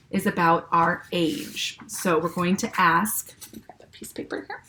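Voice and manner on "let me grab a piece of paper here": aside. Real, not acted. Teacher aside off-mic.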